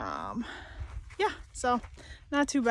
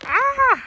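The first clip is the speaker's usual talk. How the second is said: sharp high loud squeal; excited squeal; 14 semitones higher, 10 dB louder than usual. Real, not acted. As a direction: squeal with delight.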